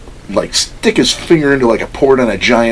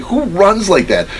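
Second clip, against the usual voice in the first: high, slow incredulous question; retold disbelief; 10 semitones higher, slower than usual. Recalling his odd running, incredulous high question. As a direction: ask it high, stretching the words. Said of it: "I'm like".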